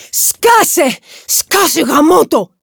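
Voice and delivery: high, loud angry scream; furious yell. A narrator's reading. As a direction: yell to silence someone, at full force. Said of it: "ουρλιάζει ο Άρον". (οργισμένο ουρλιαχτό)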